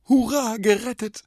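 very high, loud cheer; erleichterter Jubel. A narrator's reading. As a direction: burst out in joyful relief. Boy's relieved inner cheer. (hoch und laut gejubelt)

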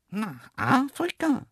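very high, drawn-out cartoon voice; astonished antelope. Antelope hearing of the Africa trip, dumbfounded high voice. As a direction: echo it slowly in high-pitched amazement. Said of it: "klappte das unsichtbare Kinn herunter".

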